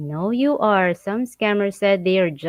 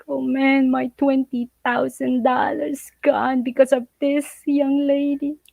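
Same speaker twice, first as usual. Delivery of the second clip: mock crying foreigner; 5 semitones higher than usual. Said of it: "other foreigner crying".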